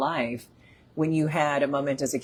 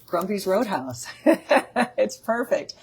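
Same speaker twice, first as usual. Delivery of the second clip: laughing; 5 semitones higher than usual. Real, not acted. Laughing at own joke.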